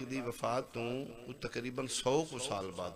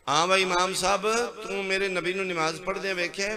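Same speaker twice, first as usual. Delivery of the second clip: high, loud questioning call; loud demand; 6 semitones higher, 7 dB louder than usual. Acted. Allah loudly asks the imam about the Prophet's prayer.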